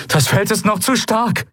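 high, strained report; urgent warning. Acted. Eidolon to Kai at the force field, high urgent warning. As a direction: warn that it is not working.

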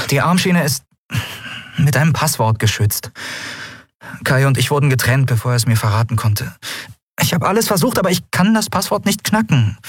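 exasperated confession, high and in character; frustrated Endriel. Acted. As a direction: admit you're stuck, pitch climbing. Endriel admits she is stuck.

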